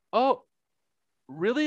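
put-on high, surprised girl voice; mock surprise. Retelling the girl's surprise.

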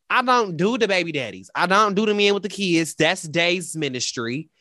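ordinary talk, nothing marked

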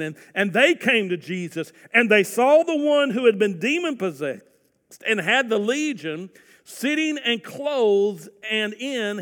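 fervent pulpit reading; forceful scripture reading. Acted. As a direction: build the story with energy. Scripture read with rising force.

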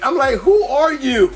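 high, loud baffled challenge; incredulous shout. Retold or imitated. Man challenging a stranger's advances, incredulous. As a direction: demand it in comic outrage.

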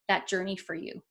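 normal talking voice

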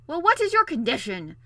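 loud, high, impatient demand; exasperated royal outburst; growled. A narrator's reading. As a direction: demand it with grudging anger. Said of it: "growled the king".

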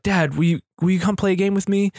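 put-on high, pleading child's voice; little kid voice. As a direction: ask like an eager little boy. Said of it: "when Odin is like".

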